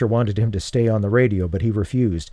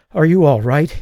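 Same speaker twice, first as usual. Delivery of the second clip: out of breath, higher and louder; panting; 5 semitones higher, 5 dB louder than usual. A narrator's reading. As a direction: pant it out after the fight. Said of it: "he panted".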